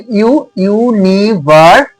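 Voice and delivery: ইউনিভার্সিটি slowly split into syllables; slow teaching demo of ইউ at the start of ইউনিভার্সিটি; syllable-by-syllable pronunciation. Shown as a demonstration. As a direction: sound out ইউনিভার্সিটি piece by piece, leading with ইউ. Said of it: "উচ্চারণটা কিরকম হচ্ছে".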